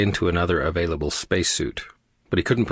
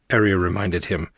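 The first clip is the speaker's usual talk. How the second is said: ordinary delivery, nothing marked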